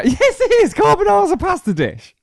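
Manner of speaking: high-pitched, incredulous insistence; protesting way up high; indignant protest. Real, not acted. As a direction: squeal it out as if outraged to be doubted. Indignant protest in argument over carbonara.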